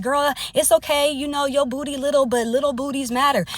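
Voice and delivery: mock a friend who sugarcoats everything; coddling best friend. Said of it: "Y'all got y'all best friend like".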